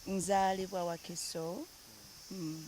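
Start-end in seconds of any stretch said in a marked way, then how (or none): none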